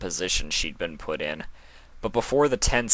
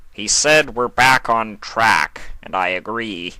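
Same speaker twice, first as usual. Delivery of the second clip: snarling; 3 semitones higher, 6 dB louder than usual. A narrator's reading. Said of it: "Nightmare Moon snarled".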